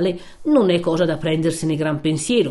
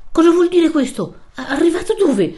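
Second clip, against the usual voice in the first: high, loud startled outburst with a nervous laugh; 11 semitones higher, 6 dB louder than usual. Acted. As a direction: jump up alarmed at the news. Don Abbondio startled by news.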